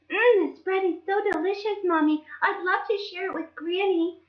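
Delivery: put-on high, sweet little-girl voice; Little Red. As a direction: play the eager little girl asking mommy.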